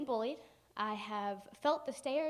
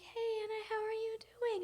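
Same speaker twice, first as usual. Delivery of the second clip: high, put-on sweet greeting; overfriendly classmates; 11 semitones higher than usual. Scared classmates greeting the girl, mock-friendly high voice. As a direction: imitate nervously nice schoolmates, pitched way up. Classmates being suddenly nice to her.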